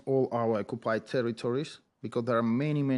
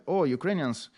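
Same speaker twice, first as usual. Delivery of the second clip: mock foreigner; 6 semitones higher, 5 dB louder than usual. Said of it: "we don't want to be identified".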